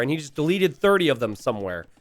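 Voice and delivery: ordinary delivery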